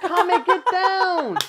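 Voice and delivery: high, loud, drawn-out call. Real, not acted. Calling someone to come down, drawn-out loud call. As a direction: stretch it out to reach them across the room.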